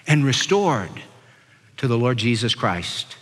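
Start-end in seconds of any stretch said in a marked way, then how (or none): none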